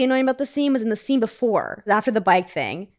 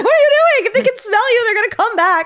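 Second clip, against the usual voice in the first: much higher, louder exasperated mock yell; yelling at the screen; 13 semitones higher, 7 dB louder, faster than usual. Host shouting at movie characters, higher, louder exasperated yell. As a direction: scold the characters in disbelief. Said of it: "I'm like".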